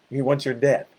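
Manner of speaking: usual speaking voice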